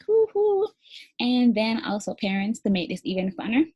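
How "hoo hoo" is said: high, loud hoo hoo; a happy high whoop; gleeful hoot. Shown as a demonstration. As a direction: whoop with delight. Cheer after the silly reading.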